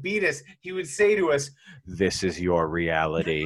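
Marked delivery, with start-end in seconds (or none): 1.9-3.5 a cartoon father bear's hammed-up menace; menacing bear voice. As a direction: drop the voice way down like a cruel cartoon bear. Abusive bear quoted.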